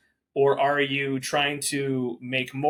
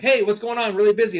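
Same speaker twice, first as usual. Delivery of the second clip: mock harried hello, higher and louder; rushed busy broker; 7 semitones higher, 7 dB louder than usual. Broker answering her call, rushed and harried. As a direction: play the swamped broker picking up mid-rush. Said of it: "they're like".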